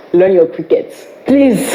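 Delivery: normal talking voice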